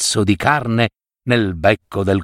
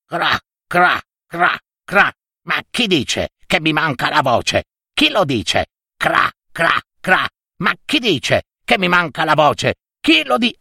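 higher, croaky offended crow voice; croaking crow; 5 semitones higher than usual. Acted. Crow croaking down at the fox, indignant and showing off. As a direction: caw it out proudly, stung by the insult. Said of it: "aprì il becco e gracchiò".

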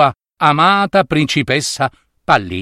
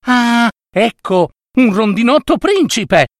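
amazed; 6 semitones higher than usual. A narrator's reading. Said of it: "disse stupito Trottolino".

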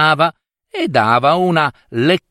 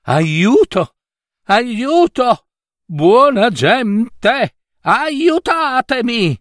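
much higher, desperate slow yell for help; shouting as loud as he can; 11 semitones higher, slower than usual. A narrator's reading. Prince hears a noise and screams louder, desperate high yell. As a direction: scream for rescue with all your strength. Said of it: "gridò più forte che poté".

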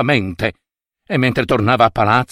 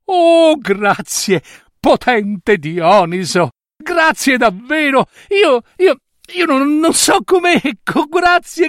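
raised, eager voice of an overjoyed king; stammering grateful Mida, higher; high excited king; 10 semitones higher than usual. Acted. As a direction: gush your thanks like a greedy king overwhelmed. Mida thanks the god.

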